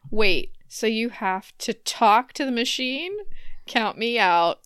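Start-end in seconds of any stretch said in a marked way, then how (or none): none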